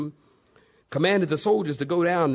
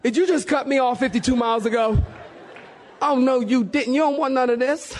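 mock road rage; 8 semitones higher, faster than usual.

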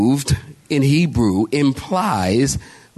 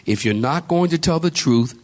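ordinary talk

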